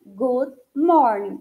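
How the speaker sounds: good morning said slowly and carefully; correct pronunciation. Shown as a demonstration. Teacher repeats correct good morning for students. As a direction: drill good morning, ending clean. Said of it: "aprender a falar certinho".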